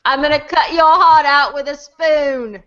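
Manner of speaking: put-on English accent. Quoting Alan Rickman's villain to friends in an English accent. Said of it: "That was my best English accent".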